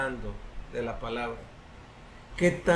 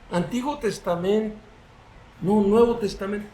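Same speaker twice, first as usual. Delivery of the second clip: unsure believer guessing; 5 semitones higher than usual. Acted. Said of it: "Hay personas que dicen".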